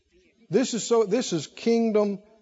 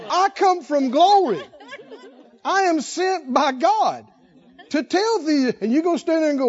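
preaching the angel; 7 semitones higher than usual. Acted. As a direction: thunder it down at the doubter.

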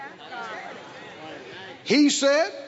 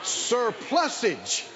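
drawn-out, ringing sermon declarations; emphatic preacher stretching the key words; fervent preaching; 4 semitones higher than usual. Acted. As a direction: proclaim it slowly for the congregation to shout back. Preaches to a responding congregation.

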